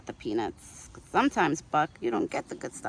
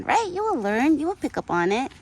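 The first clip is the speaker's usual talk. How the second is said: high, louder sing-song coo to a pet; pet talk; 8 semitones higher, 5 dB louder than usual. Real, not acted. Woman coos at her pet deer, high and bright.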